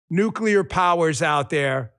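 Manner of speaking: ordinary talk, nothing marked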